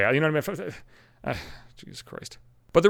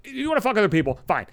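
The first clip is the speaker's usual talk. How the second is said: normal talking voice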